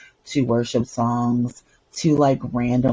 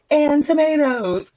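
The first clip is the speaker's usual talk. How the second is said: put-on child's voice; 14 semitones higher, 4 dB louder, slower than usual. Student voiced in story.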